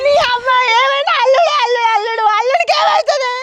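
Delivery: high, crying cry of alarm; a mother shrieking in fear through tears; panicked crying shout. Acted. Mother panics over son-in-law.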